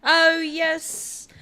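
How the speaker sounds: loud, high burst of excitement; big hyped-up reaction; excited cheer. Real, not acted. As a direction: cheer it out loudly. Excited countdown to going live.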